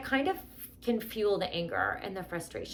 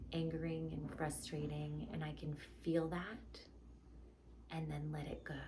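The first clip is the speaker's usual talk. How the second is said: guided meditation; 3 semitones lower, 8 dB quieter than usual. Acted. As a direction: guide them gently into calm.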